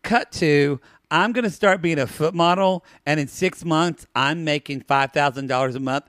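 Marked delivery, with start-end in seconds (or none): none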